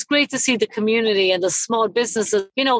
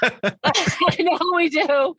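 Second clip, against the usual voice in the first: laughing; 7 semitones higher, much slower than usual. Real, not acted. Laughs while agreeing.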